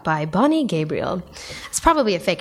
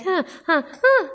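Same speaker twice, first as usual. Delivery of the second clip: mock moaning; 8 semitones higher, slower than usual. Host mocking awkward moaning, hammed-up huh. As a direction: ham up the moan. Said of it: "when they're like".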